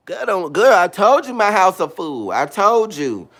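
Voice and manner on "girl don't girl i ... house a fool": normal talking voice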